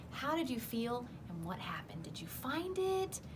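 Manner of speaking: ordinary delivery, nothing marked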